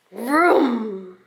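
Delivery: plain reading voice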